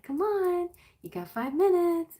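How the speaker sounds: high, sweet coaxing; lightly cheerful prodding of a sleepy child; gentle wake-up nudge. Retold or imitated. Mother coaxing her kid out of bed, high and sweet.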